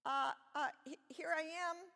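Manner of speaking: high, loud frightened stutter; scared stammer. A narrator's reading. Scared Moses answers the voice in the bush, high nervous stammer. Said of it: "Moses was scared".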